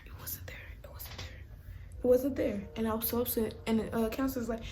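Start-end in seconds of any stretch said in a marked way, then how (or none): 0.1-1.4 hushed, voiceless repetition; whispered dismay over a loss. Retold or imitated. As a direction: whisper it in mock disbelief. Recounting the missing charger, repeated whispered.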